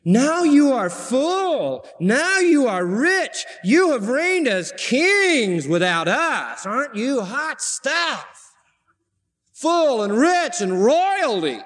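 high, drawn-out mocking praise; sarcastic. Preacher as Paul mocking the self-satisfied Corinthians, sarcastic. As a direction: crown them with slow, high-pitched sarcasm. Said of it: "he gets so sarcastic".

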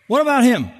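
Peter pointing at John. Retold or imitated. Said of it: "he says, "Lord".

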